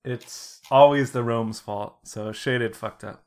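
usual speaking voice

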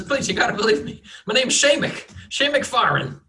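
put-on high cracked old farmer pleading innocence. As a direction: beg like a frightened old farmer.

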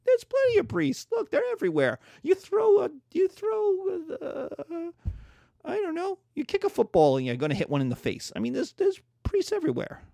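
high, hammed-up smug cleric; mocking Vatican voice. Vatican officials boasting to each other, mocking high voice. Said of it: "sitting in the Vatican going".

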